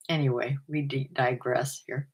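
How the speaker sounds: ordinary talk, nothing marked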